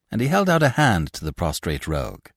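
plain reading voice

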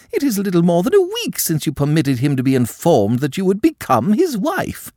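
high, loud, outraged aristocrat; indignant countess. A narrator's reading. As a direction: scold the girl with wounded pride. Madame protests Aline's refusal.

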